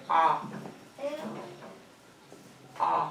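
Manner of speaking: AH as an isolated, held vowel; modelled vowel sound. Shown as a demonstration. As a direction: model a clear AH for a pupil. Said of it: "Look at my mouth".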